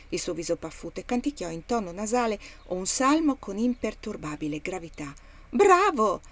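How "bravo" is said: higher, much louder delighted shout. Acted. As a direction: yell your approval across the room. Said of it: "gridò Haley". (grido entusiasta, più acuto e molto più forte)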